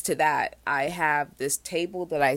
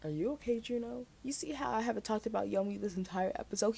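talking to a pet; 6 semitones higher, 7 dB quieter than usual. Real, not acted. Talking to her cat.

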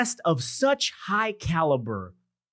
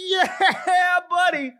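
hype shout; 14 semitones higher than usual. Pretend. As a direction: fire up the whole room. Hyping crowd after big introduction.

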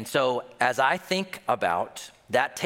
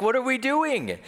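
high-pitched, louder baffled outcry; mock bewilderment; 11 semitones higher, 3 dB louder than usual. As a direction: act out a bewildered family, voice way up. Retold family reacting to alien attack.

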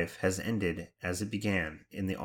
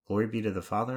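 ordinary talk

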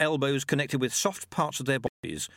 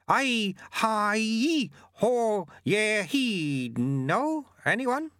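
put-on higher, lively old monk; small monk voice; 11 semitones higher, much slower than usual. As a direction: call out like a spry little monk. Narrator voices Lutze calling out.

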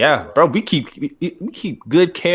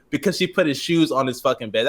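usual speaking voice

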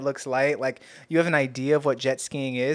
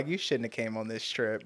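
softer, lower under-breath remark; muttered aside; 4 semitones lower, 9 dB quieter than usual. Retold or imitated. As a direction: say it quietly to yourself. Muttered thought about sick tourists.

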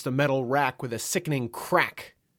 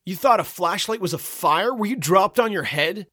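high, loud, irate rhetorical questions; chewing out; 7 semitones higher, 6 dB louder than usual. A narrator's reading. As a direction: berate someone in a raised, higher voice. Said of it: "chewing me out".

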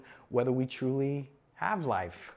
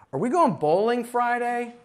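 put-on high, eager teen voice; imitating a teenager asking, pitched up; clueless teenager; 9 semitones higher, 5 dB louder than usual. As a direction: play the kid who missed the point. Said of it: "He goes".

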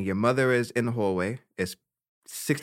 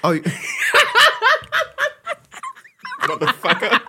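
high squealing laugh into a cuss; cracking up mid-cry; laughing outburst; 5 dB louder than usual. Real, not acted. Laughing hard as friend recalls the night.

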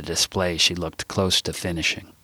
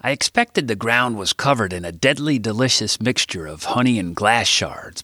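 normal talking voice

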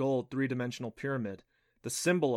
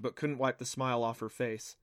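usual speaking voice

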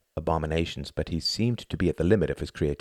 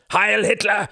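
loud, much higher harsh shout; harsh cry; 8 dB louder than usual. A narrator's reading. A stranger calling out behind them, harsh loud shout. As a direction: bark the salute. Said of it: "cried a harsh voice behind them".

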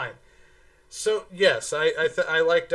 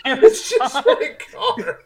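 mock bystander echo; 8 semitones higher, 8 dB louder, much slower than usual. Friend echoes the joke line.

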